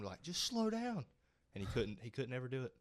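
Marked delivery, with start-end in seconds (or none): 0.2-1.0 mock advice. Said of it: "'cause we told him".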